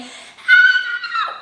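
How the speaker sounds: very high, loud squealed ah; screaming. Shown as a demonstration. Teacher shows the voice not to use, screamed. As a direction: shriek a high thin ah. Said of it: "I'm not screaming".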